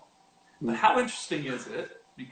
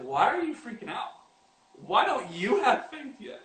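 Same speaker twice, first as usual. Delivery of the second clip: incredulous self-rebuke; 8 semitones higher than usual. Retold or imitated. Telling ourselves off for fear, higher incredulous scold. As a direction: rebuke yourself for panicking. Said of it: "look at ourselves and go like".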